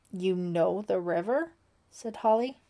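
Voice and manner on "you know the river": mildly puzzled question, rising at the end; confused. A narrator's reading. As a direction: ask it with sudden confusion. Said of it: "suddenly confused".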